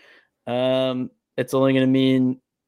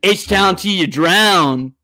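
slow, higher taunting chant; mocking taunt; 5 semitones higher, 3 dB louder, much slower than usual. Taunting a Houston fan leaving the stadium, slow mocking chant. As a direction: drag it out to rub it in. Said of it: "And I just go".

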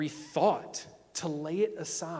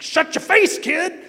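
much higher, much louder hammed-up shout; mock yell at kid; 13 semitones higher, 14 dB louder, slower than usual. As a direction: bark it at the bratty kid. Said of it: "I'm like".

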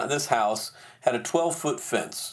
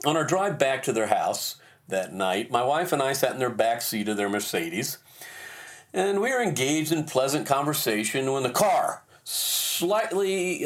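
ordinary delivery

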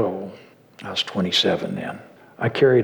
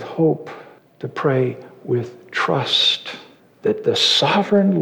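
raised, fervent pulpit voice; sermon delivery building to fervent peaks; preaching; 4 semitones higher than usual. Acted. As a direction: preach it out to the whole congregation. Preaching to the congregation.

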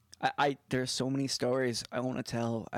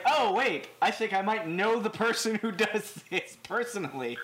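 excited; 9 semitones higher, 4 dB louder than usual. Retold or imitated. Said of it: "I was like".

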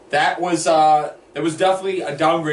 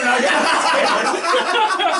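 laughing; 8 semitones higher, slower than usual. Real, not acted. Group cracking up.

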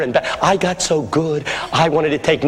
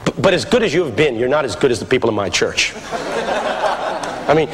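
normal talking voice

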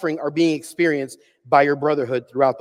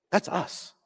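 normal talking voice

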